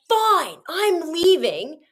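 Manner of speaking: imitating her stomping off in a slow, sulky huff; mock angry snap. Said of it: "she gets mad".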